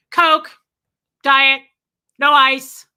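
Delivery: loud, blunt one-word bursts; curt ex-husband ordering. Retold or imitated. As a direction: bark the drink order, pausing between items. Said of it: "come at me like that".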